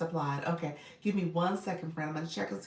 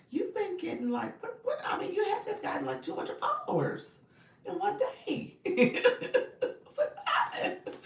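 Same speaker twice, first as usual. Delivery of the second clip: high, laughing disbelief; excited laughing. Real, not acted. Host laughing in amazement at a viewer's sudden growth. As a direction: gush through giggles at her success. Gushing over viewer's follower jump.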